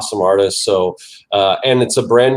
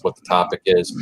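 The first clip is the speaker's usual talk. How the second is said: usual speaking voice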